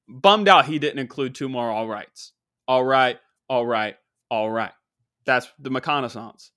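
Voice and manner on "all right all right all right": lightly imitated low, laid-back catchphrase; a hint of the actor's catchphrase delivery; McConaughey catchphrase. Adding the actor's missing catchphrase, light McConaughey imitation.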